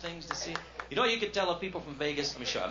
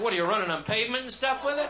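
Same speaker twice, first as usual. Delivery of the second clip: sarcastic retort; 8 semitones higher, 5 dB louder than usual. Retold or imitated. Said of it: "I said".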